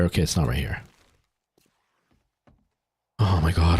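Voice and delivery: usual speaking voice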